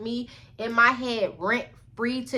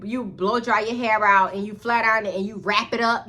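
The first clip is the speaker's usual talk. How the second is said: usual speaking voice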